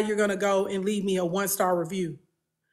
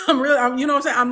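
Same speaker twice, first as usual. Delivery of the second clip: laughing it out, louder and higher; 4 semitones higher, 7 dB louder, much faster than usual. Real, not acted. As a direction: burst into a real laugh mid-sentence. Laughs at her own bluntness.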